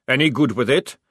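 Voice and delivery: loud barking drill sergeant; gruff sergeant. A narrator's reading. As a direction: bark it like an army sergeant.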